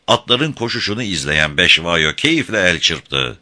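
plain reading voice

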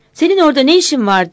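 loud telling-off down the phone; angry shout. A narrator's reading. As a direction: shout the question as a demanding challenge. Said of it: "diye bağırdı".

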